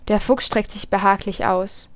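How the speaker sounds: ordinary delivery, nothing marked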